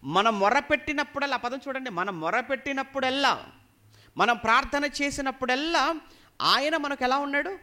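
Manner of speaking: high, loud preacher's call; emphatic preaching. Real, not acted. Preacher asks congregation how near God is, preaching forcefully. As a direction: drive each phrase home to the congregation, high and loud.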